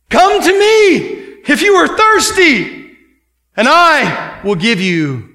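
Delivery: high, loud proclaiming cry; Jesus crying out. Acted. Jesus crying out to the thirsty festival crowd, loud and high. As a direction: call out to the whole crowd. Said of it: "the text says he screams".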